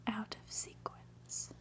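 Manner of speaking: plain reading voice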